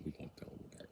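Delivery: whispered to co-conspirators; conspiratorial whisper. Acted. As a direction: breathe it as a secret pact. Secret agreement with council.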